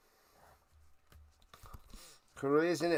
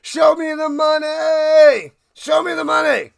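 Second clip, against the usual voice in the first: full-throated shout at the agent; movie shout; 10 semitones higher, 12 dB louder than usual. Acted. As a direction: bellow it like a player demanding his pay. Acting the movie's famous shout.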